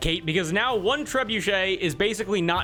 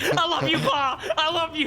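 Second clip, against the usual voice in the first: much higher, laughing farewell; tearful son bow; 10 semitones higher than usual. Acted. Son bow to its father, high laughing farewell. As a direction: gush a last goodbye. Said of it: "And it's like".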